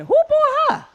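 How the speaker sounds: high, squeaky incredulous mock question; imagined snide onlooker. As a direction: squeal it like a gossip staring at her. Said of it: "I know what they thinking".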